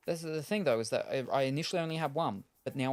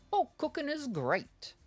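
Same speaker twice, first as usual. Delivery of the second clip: lilting high voice, slowed; sing-song high; slower than usual. Pretend. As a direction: read it in a bright sing-song. Reading game menu in sing-song voice.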